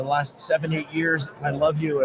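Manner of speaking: usual speaking voice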